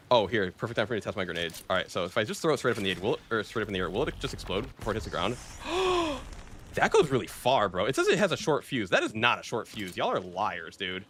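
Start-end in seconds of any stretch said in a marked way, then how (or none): 5.4-6.7 surprised gasp. Real, not acted. Grenade flies far.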